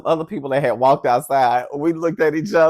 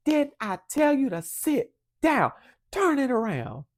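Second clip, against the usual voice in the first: high-pitched telling-off, no louder than talk; exasperated mother voice, pitched up; scolding mother; 10 semitones higher than usual. Retold or imitated. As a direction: imitate a mom laying down the law. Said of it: "she was so loud".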